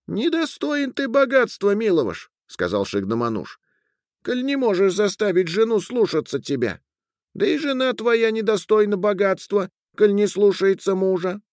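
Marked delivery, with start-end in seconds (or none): 0.1-2.3 very high, thin little voice; Shignamanush. A narrator's reading. Little being reproaching the man for losing riches, thin and high. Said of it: "тоненький голос Шигнамануша". 4.2-11.4 very high, thin little voice; Shignamanush. A narrator's reading. Little being reproaching the man for losing riches, thin and high. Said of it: "тоненький голос Шигнамануша".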